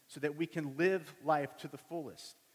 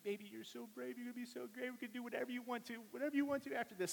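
put-on high, soft baby-talk voice; gently gushing, pitched way up; soothing mother; 9 semitones higher, 5 dB quieter, faster than usual. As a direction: imitate a mom coaxing in a quick sweet rush. Wife soothing the frightened boy.

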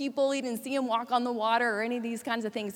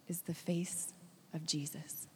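much softer, breathy hush; awed whisper; 5 semitones lower, 11 dB quieter than usual. Acted. Preacher hushes as the blind man first sees Jesus, awed whisper. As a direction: let it fall to a reverent whisper.

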